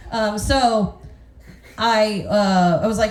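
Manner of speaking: ordinary delivery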